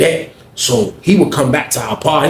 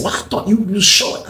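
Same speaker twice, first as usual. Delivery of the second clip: surprised; 8 semitones higher than usual. Retold or imitated. Cliff surprised.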